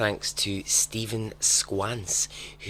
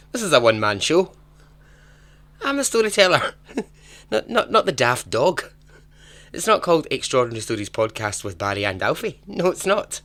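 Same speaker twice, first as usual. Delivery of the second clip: mock indignant; 5 semitones higher, 6 dB louder than usual. Mock indignant rant about dog.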